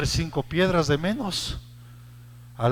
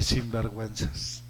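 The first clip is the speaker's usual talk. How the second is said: soft scolding; 6 semitones lower, 6 dB quieter, slower than usual. Retold or imitated. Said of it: "Por eso yo les dije".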